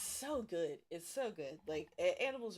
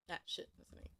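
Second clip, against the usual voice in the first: whispered aside; 8 dB quieter, much faster than usual. Real, not acted. Aside to self.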